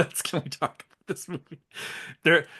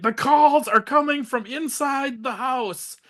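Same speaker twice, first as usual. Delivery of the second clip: high, slow, dramatic reveal line; dramatic cop reveal; 10 semitones higher, 3 dB louder, slower than usual. Retold or imitated. Cop revealing the calls come from inside, hammed-up and slow. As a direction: ham up the famous horror line. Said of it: "And then he's like".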